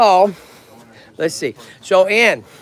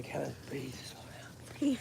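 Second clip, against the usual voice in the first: soft calming whisper; 20 dB quieter than usual. Pretend. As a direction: hush it to calm Kevin down. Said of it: "and you can say".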